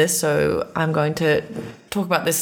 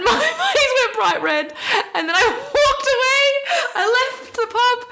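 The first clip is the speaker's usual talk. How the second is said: laughing through tears. Real, not acted. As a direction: crack up as it comes out. Laughing-crying about embarrassing pub story.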